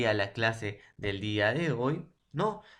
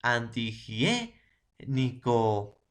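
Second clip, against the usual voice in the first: "Antihigiénico" said slowly, syllable by syllable; much slower than usual. Shown as a demonstration. Teacher to class, another example: slow syllables. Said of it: "De la misma manera".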